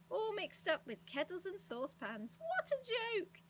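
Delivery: high-pitched gleeful exclamation; delighted. A narrator's reading. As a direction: let the glee climb to a high finish. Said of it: "said Moonface in delight".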